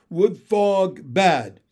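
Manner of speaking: slow, loud frog talk; accusing frog husband. Acted. Frog husband condemns the wood frog, loud and slow. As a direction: pound out each word slowly.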